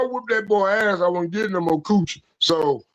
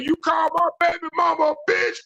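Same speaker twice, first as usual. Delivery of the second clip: mock angry challenge; 9 semitones higher than usual.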